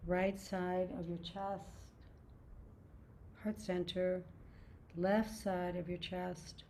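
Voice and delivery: calm, even meditation voice; guided meditation. Acted. Reads Yoga Nidra body scan.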